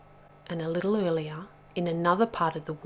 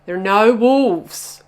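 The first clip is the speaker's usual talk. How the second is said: loud, slow, emphatic reassurance; firm assurance; 4 semitones higher, 14 dB louder, slower than usual. A narrator's reading. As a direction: put them right, slowly and loudly. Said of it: "assured Bubba".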